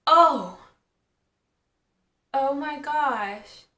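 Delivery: a loud startled oh, then a slow groan of disbelief; real dismay. Real, not acted. Vlogger realising the video cut a scene, startled and slow. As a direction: catch the mistake, then sink.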